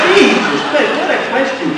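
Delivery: laughing disbelief. Real, not acted. Disbelief at the interview question.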